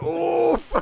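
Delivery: loud winded high oof; knocked-breath grunt; big oof. Acted. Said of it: "he gives a big fucking".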